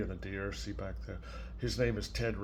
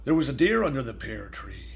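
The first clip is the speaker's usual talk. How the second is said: put-on reading to a child; 4 dB quieter than usual. Grandparent reading Salt Hands to a small child, gentle storybook voice. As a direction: read it softly, like a bedtime story. Reads picture book aloud.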